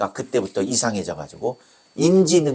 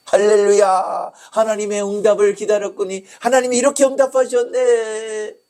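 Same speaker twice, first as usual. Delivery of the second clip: acted jubilation, stretched and raised; exultant praise; 10 semitones higher, 4 dB louder than usual. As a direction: celebrate in a long, high-pitched call. Exultant praise on getting visa.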